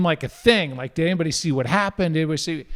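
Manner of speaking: normal talking voice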